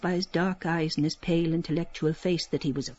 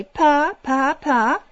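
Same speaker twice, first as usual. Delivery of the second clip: put-on high, louder baby syllables; baby talk; 6 semitones higher, 6 dB louder, much slower than usual. Cissy getting the baby to say papa, high coo. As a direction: coo it out like a toddler's first word. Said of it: "and say".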